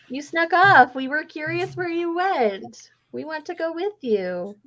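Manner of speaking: high, excited voices of the gang who tagged along; eager kids pestering. Retold or imitated. The gang to Yoon who snuck off, high and eager. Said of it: "everybody else is like".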